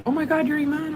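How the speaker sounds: high, breathy-free excited fangirl imitation; high fan voice. Woman at the airport gate recognizing her, high fan voice. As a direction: squeal it like a starstruck fan. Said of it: "a woman comes up to me and she's like".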